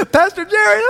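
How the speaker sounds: high, loud giggling call of a name; laughing kids. Retold or imitated. Kids on the bus teasing painted pastor, high giggling. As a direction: mimic giggling teens teasing their leader. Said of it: "And they're laughing".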